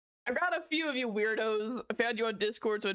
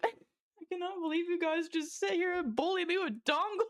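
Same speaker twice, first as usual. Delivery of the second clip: high, helpless protest through laughter; crying with laughter; 7 semitones higher than usual. Real, not acted. Streamer bullied by chat with dongle jokes, crying with laughter. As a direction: voice rising and cracking with real laughs. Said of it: "I'm crying, dude".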